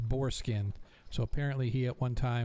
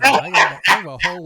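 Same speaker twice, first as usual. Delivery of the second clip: loud insistence; 6 semitones higher, 16 dB louder, much faster than usual. Real, not acted. Flustered GM defends himself.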